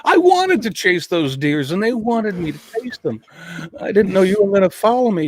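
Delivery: lightly voiced dog talking back; dog's voice. Owner voices his dog sassing him back, lightly voiced.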